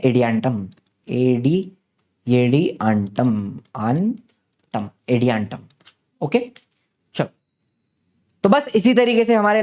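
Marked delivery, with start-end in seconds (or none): 0.0-5.6 एडियांटम broken into syllables, slow and lower. Shown as a demonstration. Teacher sounding out Adiantum for students. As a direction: spell it out slowly, एडी then antum. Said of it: "ये last example क्या है".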